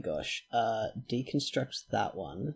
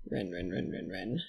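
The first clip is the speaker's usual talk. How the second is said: much softer, lower murmur of the name; soft calling; 6 semitones lower, 9 dB quieter than usual. Real, not acted. Worried about Ren, softly and lower calling. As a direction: coo the name gently. Tenderly calling injured colonist.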